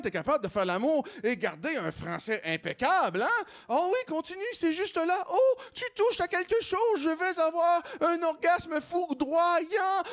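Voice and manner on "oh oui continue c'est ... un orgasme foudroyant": much higher, hammed-up moaning; mock moaning woman. Woman in bed to her lover, hammed-up, much higher.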